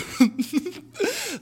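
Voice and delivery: high, almost crying laugh; high laughing fit. Real, not acted. Telling the story, high laughing fit. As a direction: laugh till it hurts.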